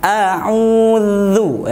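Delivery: a'udzu, tongue touching upper teeth; dzal with tongue out. Shown as a demonstration. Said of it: "lidahnya sedikit keluar".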